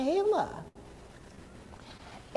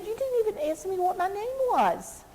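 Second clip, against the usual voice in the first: high, fast, indignant protest; indignant little girl; 11 semitones higher, faster than usual. Acted. Little girl tells stranger she never asked, indignant. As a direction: pipe up quickly with a reproach. Story girl reproaches Amanda.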